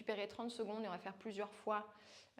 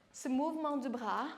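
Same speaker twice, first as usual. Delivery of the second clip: normal talking voice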